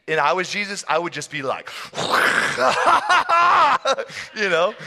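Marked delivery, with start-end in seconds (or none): 1.7-4.3 high, loud burst of laughter; exaggerated laughing fit; mock belly laugh. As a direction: guffaw right at someone. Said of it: "laughing at him".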